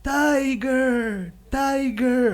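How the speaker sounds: high, drawn-out call; high-pitched lingering holler. Acted. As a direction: call over a cliff edge, holding the vowels. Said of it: "the rabbit is calling".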